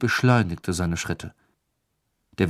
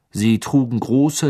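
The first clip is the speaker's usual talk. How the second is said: ordinary delivery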